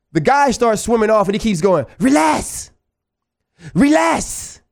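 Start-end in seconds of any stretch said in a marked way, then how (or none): 2.0-4.6 high, drawn-out mimicked call; high-pitched mock holler; imitated "relax" call. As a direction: mimic a guy calling out to brush you off. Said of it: "He sounds like the dude on The Proposal".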